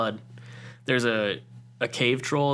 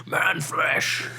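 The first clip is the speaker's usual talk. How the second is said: orc growl; 6 semitones higher than usual. Acted. Jokey orc growl after laughs.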